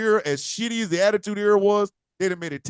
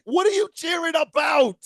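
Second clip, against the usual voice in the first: mock disbelief at full volume; baffled shout; 10 semitones higher than usual. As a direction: yell in disbelief at the cheering fans. Incredulous mock Cena.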